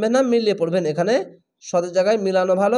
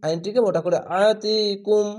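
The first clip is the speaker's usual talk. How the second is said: ordinary talk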